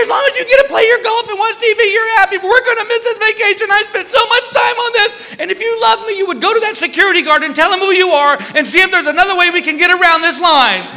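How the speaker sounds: hammed-up weepy accusation; tearful wife rant. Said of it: "Here comes the tears".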